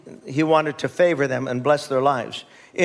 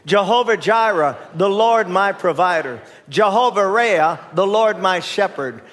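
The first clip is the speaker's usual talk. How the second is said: leading group recitation; 3 semitones higher than usual. Real, not acted. Preacher has congregation say God's names together, measured recitation. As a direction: lead the room in saying it together. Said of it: "Let's all say 'em together".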